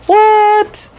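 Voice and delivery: sudden disbelief, pitch jumping way up; shocked what. Retold or imitated. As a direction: caught off guard by her own speech. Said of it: "and I'm just like".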